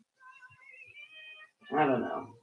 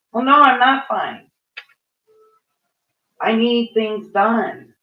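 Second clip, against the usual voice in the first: mad; 4 semitones higher, 7 dB louder than usual. Real, not acted. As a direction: refuse being called fine, with real frustration. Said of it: "I mean, I'm mad".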